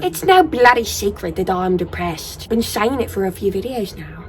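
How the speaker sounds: a hammed-up, clumsy British accent; bad British accent. Vlogger joking about her depression in a bad British accent. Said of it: "That was a horrible, nasty accent".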